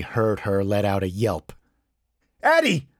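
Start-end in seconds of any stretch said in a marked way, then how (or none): 2.4-2.9 high, loud cry of her name; yell. A narrator's reading. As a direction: yell for a friend in alarm. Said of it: "I yelled".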